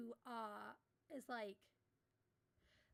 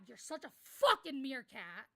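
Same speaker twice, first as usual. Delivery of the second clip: loud playful jab; 11 dB louder, slower than usual. Real, not acted. Teasing someone off-mic.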